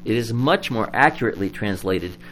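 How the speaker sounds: usual speaking voice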